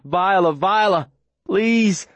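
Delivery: high slow pleading call; desperate calling. Acted. Boy running to the fallen girl, desperate and high. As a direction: call her name as you run, begging.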